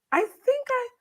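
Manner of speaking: high-pitched, tentative reader; hesitant fan. Retold or imitated. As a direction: imitate a fan unsure in a high voice. Imitates fan slowly recognising her.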